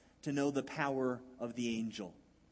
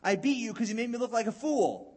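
snapping right back, louder and higher; Balaam snapping back at his donkey, angrily; angry retort; 6 semitones higher, 6 dB louder than usual. Acted. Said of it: "He just responds right back".

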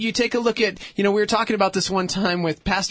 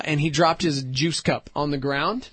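ordinary delivery

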